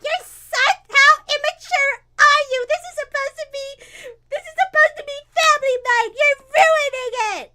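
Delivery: put-on very high, loud kid tantrum; getting mad. Valise mad at Trunks on family night, high furious yelling. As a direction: shriek accusations at a playmate. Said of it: "getting mad".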